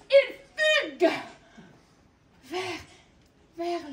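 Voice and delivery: high, loud, slow exclaim; soaring exclaim. A narrator's reading. Narrator soaring high as the bird takes off. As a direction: let the voice soar with the bird.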